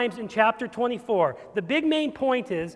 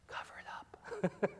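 conspiratorial whisper; 13 dB quieter than usual. Acted. Preacher whispers the guilty witness's cover-up. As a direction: hiss it like a secret.

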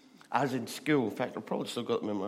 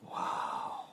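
awed wow; 4 dB quieter than usual. Retold or imitated. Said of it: "we were all like".

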